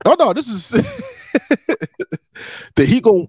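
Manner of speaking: breaking into high laughter; cracking up mid-sentence, pitch jumping high; laughing. Real, not acted. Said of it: "trying not to laugh at your own".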